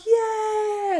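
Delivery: high cheering yeah; excited yell. Retold or imitated. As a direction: whoop back like a fan. Fan shouts back.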